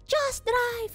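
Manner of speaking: high mock-panicked order; panicked urging. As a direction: urge it in a high, tense imitation. Roomie urging the driver, panicked.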